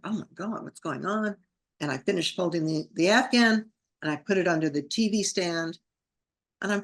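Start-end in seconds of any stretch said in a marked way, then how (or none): 0.0-1.4 soft, wondering words to oneself; a hushed reaction after crying; subdued bewilderment. Retold or imitated. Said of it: "It's like".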